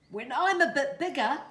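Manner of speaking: put-on high, loud baby owl voice; little morepork calling. A narrator's reading. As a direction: call down from the tree. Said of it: "the little morepork called out".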